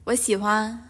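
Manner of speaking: normal talking voice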